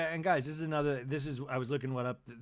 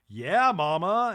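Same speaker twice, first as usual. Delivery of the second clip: mock cheer; 4 semitones higher, 10 dB louder, much slower than usual. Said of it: "she's like".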